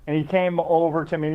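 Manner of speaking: ordinary talk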